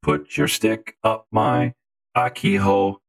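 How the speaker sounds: ordinary talk, nothing marked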